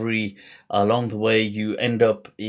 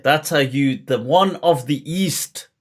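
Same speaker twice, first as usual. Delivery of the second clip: ordinary talk, nothing marked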